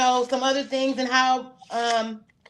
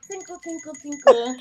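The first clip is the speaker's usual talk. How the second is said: dainty bell ring; 3 semitones higher than usual. Retold or imitated. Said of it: "it's not loud or aggressive".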